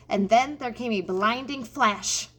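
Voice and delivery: ordinary delivery, nothing marked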